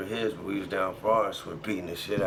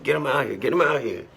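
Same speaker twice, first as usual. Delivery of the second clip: repeated, louder demand, voice pushed up; angry demand; 5 semitones higher, 4 dB louder than usual. Retold or imitated. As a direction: insist hard at the front door, getting heated. Demanding the son at the door.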